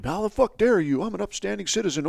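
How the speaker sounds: high-pitched outraged protest, acted; mock indignation. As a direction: play a wrongly accused man protesting hotly. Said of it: "either really pissed or really distraught".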